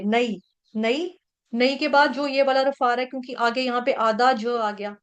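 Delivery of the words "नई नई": नई said slowly as na-ee, two clear vowels; नई not नै. Shown as a demonstration. Teacher to class, showing नई not नै. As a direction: draw out नई as two syllables, not नै. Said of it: "इसको नै नहीं पढ़ेंगे, नई पढ़ेंगे".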